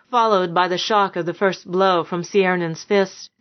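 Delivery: usual speaking voice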